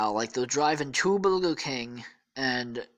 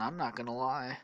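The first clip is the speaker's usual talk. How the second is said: plain reading voice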